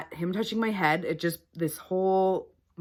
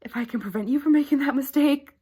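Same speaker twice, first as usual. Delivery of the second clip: whisper; 10 semitones higher, 5 dB louder, faster than usual. Real, not acted. Earnest warning to viewers.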